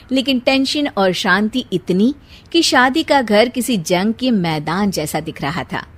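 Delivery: ordinary delivery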